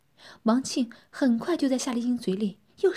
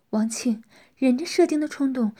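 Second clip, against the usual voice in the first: ordinary delivery, nothing marked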